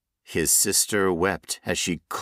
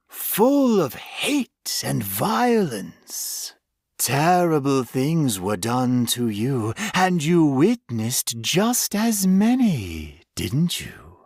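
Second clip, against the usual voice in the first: slow, lightly voiced monster savoring its words; a hint of a sinister creature reminiscing; menacing creature; 6 semitones higher, slower than usual. Creature taunting its captive.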